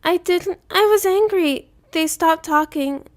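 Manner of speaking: put-on high, slow, tearful child rambling; a boy crying it all out at once; tearful babbling. A narrator's reading. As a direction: son babbling to father through tears. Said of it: "he babbled, crying into his chest".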